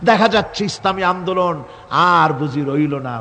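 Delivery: ordinary delivery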